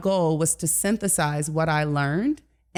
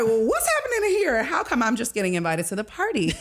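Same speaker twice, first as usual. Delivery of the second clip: high-pitched mock disbelief; retold bewilderment; 5 semitones higher than usual. Guest retelling Midwest house parties to host, mock bewildered. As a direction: ask it high, as if baffled by what you walked into.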